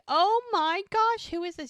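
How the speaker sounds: very high, loud mock squeal; hammed-up gushing over a pet; gushing delight. Charmaine meeting the dog Jack brought, high gushing excitement.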